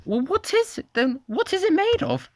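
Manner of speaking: very high squeaky questions; high curious voice. Acted. Diners asking the chef what it is, high squeaky curious voice. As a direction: ask it high and puzzled.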